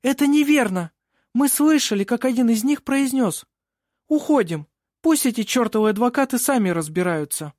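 put-on high, loud indignant girl; angry. A narrator's reading. Винга disputes the chairman's version, angry. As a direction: contradict the judge hotly. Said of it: "Тут Винга рассердилась".

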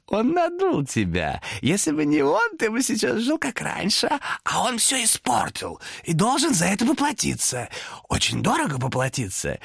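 high, slow scheming demon; loud whisper. A narrator's reading. Demon whispers to Thomas to punish the peddler. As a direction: hiss the plot into his ear. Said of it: "Демон перешёл на громкий шёпот".

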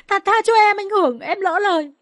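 high-pitched, panicked pleading; begging for mercy. Acted. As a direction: beg as if being yanked by the hair. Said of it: "Kha van xin".

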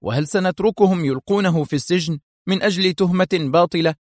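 high, heated challenge; sharp outcry. A narrator's reading. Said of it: "هتف أدهم في حدة". (اعتراض حاد بنبرة مرتفعة)

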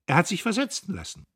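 high, fast, gloating; mock sweet gloating; triumphant. A narrator's reading. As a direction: deliver the final blow gleefully.

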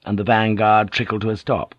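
ordinary delivery, nothing marked

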